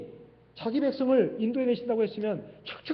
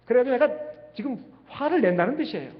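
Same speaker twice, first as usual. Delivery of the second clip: usual speaking voice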